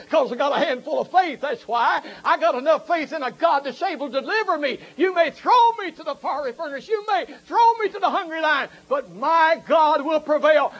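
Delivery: high, loud revival preaching; shouted camp meeting sermon; fiery preaching. Acted. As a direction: preach it hard to the congregation. Preaching at full force.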